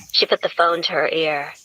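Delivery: ordinary delivery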